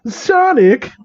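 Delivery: high startled cry of his name; hammed-up high exclamation; mock scolding yelp. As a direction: scold him in mock shock.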